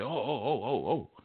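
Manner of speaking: soft startled oh's; a hint of groggy surprise; startled waking. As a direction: jolt awake, softly alarmed.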